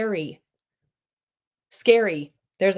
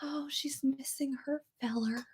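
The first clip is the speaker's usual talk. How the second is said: whisper; 5 semitones higher, 13 dB quieter than usual. Acted. Teasing aside about Juliet.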